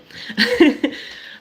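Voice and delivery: a quick high burst of laughter. Real, not acted. Host laughing at her own slip, high laugh. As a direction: laugh at yourself, high and light.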